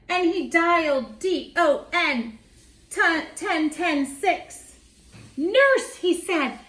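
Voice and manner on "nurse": high, loud call to the nurse; doctor calling for the nurse, urgent and loud. A narrator's reading.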